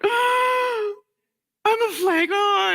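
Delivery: overdone self-pity; mock wail. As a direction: wail it like a wounded child. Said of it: "called her mom and was like".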